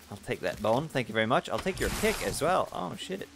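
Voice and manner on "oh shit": normal talking voice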